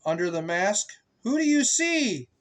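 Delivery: rising, questioning refrain; sing-song refrain. A narrator's reading. Asking the child the book's refrain, sing-song.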